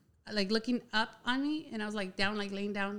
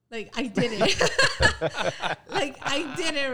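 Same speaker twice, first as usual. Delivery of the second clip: giddy laughing, louder; 5 semitones higher, 7 dB louder than usual. Real, not acted. Telling friends about the kiss, laughing loudly. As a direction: laugh the words out, proud of herself.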